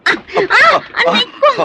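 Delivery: sharp high yelp of pain; sudden loud ouch; pained cry. Acted. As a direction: cry out as if hurt. Woman cries out in pain.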